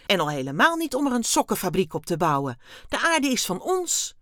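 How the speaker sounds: loud, indignant protest; outraged exclamation. A narrator's reading. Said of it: "riep hij uit". (luid verontwaardigd)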